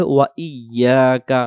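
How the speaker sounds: "ওয়া ইয়াকা" as wa iy-yaa-ka; doubled Y. Shown as a demonstration. Teacher adding wa to the word, "ওয়া ইয়াকা" with doubled y. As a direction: hold the ই-ইয়া in "ওয়া ইয়াকা". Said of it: "পরে আবার হবে".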